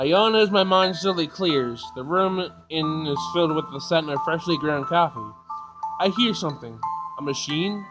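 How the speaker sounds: ordinary talk, nothing marked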